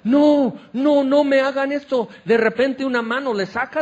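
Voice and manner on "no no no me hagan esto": high, loud cry of a trapped bird; panicked pleading. Acted. Little bird begging the cooks to spare it, panicked and loud. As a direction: beg desperately not to be cooked.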